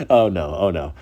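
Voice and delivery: ordinary talk, nothing marked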